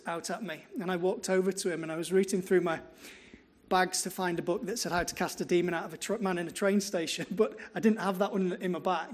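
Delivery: usual speaking voice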